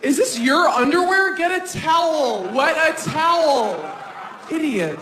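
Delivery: hammed-up exasperated girlfriend; imitating bossy girlfriend. Girlfriend barking orders.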